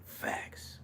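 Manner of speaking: breathy unvoiced whisper of "Facts."; just whisper "Facts.". Real, not acted. To viewers, a whispered agreeing aside.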